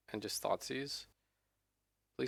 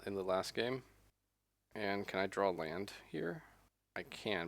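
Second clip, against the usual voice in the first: ordinary talk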